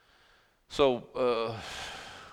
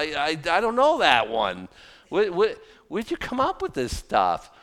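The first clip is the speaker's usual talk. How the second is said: usual speaking voice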